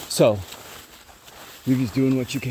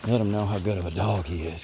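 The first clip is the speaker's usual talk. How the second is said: ordinary delivery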